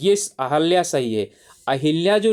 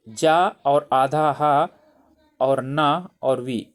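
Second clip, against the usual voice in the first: slow, deliberate letter-by-letter spelling; patient classroom spelling aloud; much slower than usual. Shown as a demonstration. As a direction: break the word into its letters slowly for students. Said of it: "teacher spelling a word by parts".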